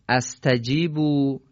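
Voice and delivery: استجیبو begun with "a": astajibu; fatha on alef. Shown as a demonstration. Teacher offering a possible reading, استجیبو said "astajibu". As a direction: open استجیبو on a short "a" sound.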